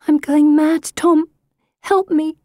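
high, pleading voice; desperate plea. A narrator's reading. Sister silently begging her brother for help, desperate. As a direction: confess it in distress.

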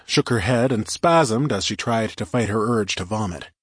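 ordinary delivery, nothing marked

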